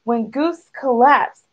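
ordinary delivery

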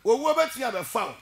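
preached loud. Real, not acted. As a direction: shout the warning to the congregation. Same warning, now preached loudly.

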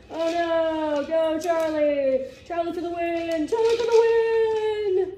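building stadium-announcer excitement, stretched vowels; mock sports commentary. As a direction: call the pet game like a championship match. Mock sports commentary on dogs eating.

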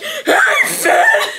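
high, loud shrieked mimic; squealed, hammy imitation; mocking Jake voice. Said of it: "You're like".

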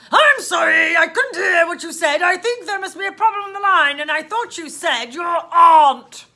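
loud officer in disbelief; bellowing policeman. Acted. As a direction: boom it down a bad line.

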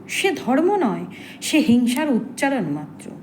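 impassioned verse with weighty pauses; dramatic poem recitation. Acted. Mandodari calls women to rise against silence, impassioned poetic recitation. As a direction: recite with rising, defiant swells and falling line endings.

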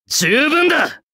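勝ち誇った高い声で; triumphant declaration. Acted. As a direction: declare it like the battle is won. Climax of battle line.

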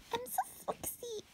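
tiny high whimpering voice; hammed-up high, soft whine; mock character voice. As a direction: squeak it softly, as if about to cry. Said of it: "Then he's like".

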